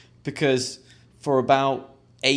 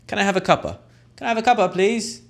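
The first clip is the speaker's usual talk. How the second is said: high, bright matey ask for a cuppa; chummy British request; 9 semitones higher, faster than usual. Shown as a demonstration. Friendly Brit asking a mate for tea, high and lively. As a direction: sing it out to your mate like a cheeky Brit.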